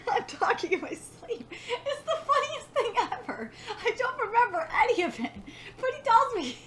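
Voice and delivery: high-pitched talk through laughter; laughing hard. Real, not acted. Reader retelling own sleep-talking, laughing hard. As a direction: tell it while cracking up for real. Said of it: "I'm laughing so hard".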